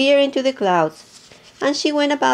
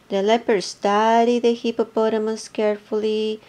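ordinary delivery

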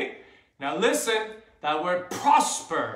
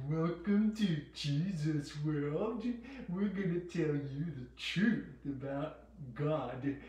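put-on devil voice, lightly played; devil posing as host; 6 dB quieter than usual. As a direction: greet the kids as a sly impostor host. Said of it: "the devil tried to tell them".

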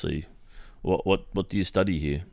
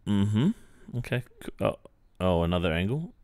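ordinary talk, nothing marked

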